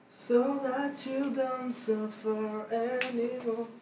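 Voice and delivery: slow, drawn-out West Coast American English; American accent. Shown as a demonstration. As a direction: coach it like a Californian. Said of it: "À la, à l'américaine".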